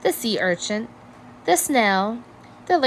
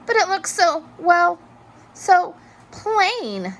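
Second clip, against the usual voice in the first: Hermit Crab; 10 semitones higher, 3 dB louder, slower than usual. A narrator's reading. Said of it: "thought Hermit Crab".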